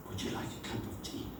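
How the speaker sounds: fast, whispered, gentle; hushed quick kindness; whispered offer. Retold or imitated. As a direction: offer it softly under the breath.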